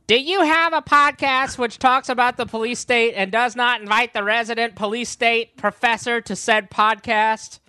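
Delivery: high, loud mock indignant friend; weak Maya impression. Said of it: "That's a weak Maya impression".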